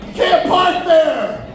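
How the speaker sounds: high, slow mock shout out the car window; mock yell. Comic heckling an abandoned car, high mock yell. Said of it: "rolls down my window and go".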